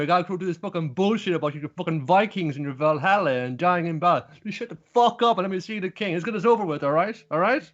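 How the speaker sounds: high, exasperated telling-off; mock fed-up rant. Thorfinn fed up with talk of Valhalla, high and exasperated.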